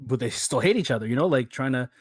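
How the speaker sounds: usual speaking voice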